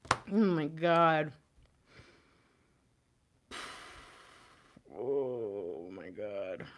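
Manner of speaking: low, soft, drained tenderness; hushed and overwhelmed; fighting tears. Real, not acted. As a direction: speak quietly through the emotion. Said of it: "Try not to cry right now".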